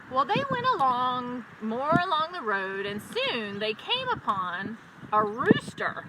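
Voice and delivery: plain reading voice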